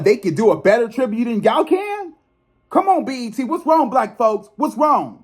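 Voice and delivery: high, incredulous scolding; exasperated call-out. Real, not acted. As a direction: shame them into doing better.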